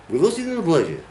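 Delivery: "велосипедная пляжа" with pitch rising high then falling; peak and trough. Shown as a demonstration. Teacher shows Russian rhythm, a high peak and trough on "велосипедная пляжа". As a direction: swoop up through "велосипедная", drop on "пляжа". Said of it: "it's a peak and trough".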